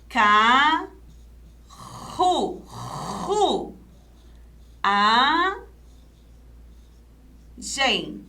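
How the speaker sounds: "Carru-, ru-, a-, gen" in slow separate syllables; long pauses between syllables, RU with breathy strong R. Shown as a demonstration. Teacher splitting an example word, syllable by syllable. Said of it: "Vamos separar em sílabas".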